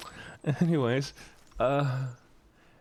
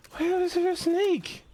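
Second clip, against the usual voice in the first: high surprised. Real, not acted. Startled by a snake.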